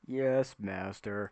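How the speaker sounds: slow, drawn-out servant's reply; obedient servant construct. Acted. Construct answering its master, slow and obedient. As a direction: answer dutifully, stretching each word.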